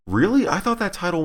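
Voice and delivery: high incredulous really; disbelieving. Real, not acted. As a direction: sound like you don't believe it. Disbelief at repeated claim.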